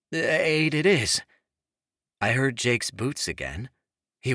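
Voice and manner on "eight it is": confident warmth. A narrator's reading. Jake confident date.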